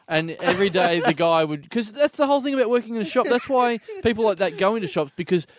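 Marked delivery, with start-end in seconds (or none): none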